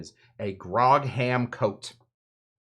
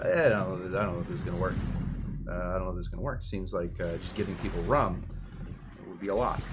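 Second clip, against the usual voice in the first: doubtful; 5 semitones lower, 5 dB quieter, much faster than usual. Retold or imitated. Voicing doubtful people's objection.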